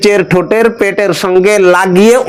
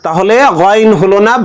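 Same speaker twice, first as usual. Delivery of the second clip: normal talking voice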